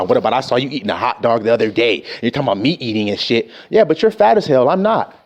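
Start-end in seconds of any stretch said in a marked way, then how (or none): 0.0-3.5 defensive retort. Retold or imitated. Acted quarrel, defensive friend.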